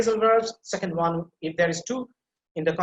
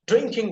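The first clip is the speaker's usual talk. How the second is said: drinking, with DRINK pushed higher; stress on DRINK; 6 semitones higher, 4 dB louder than usual. Shown as a demonstration. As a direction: lean on the first syllable of drinking. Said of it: "So drink that is emphasized here".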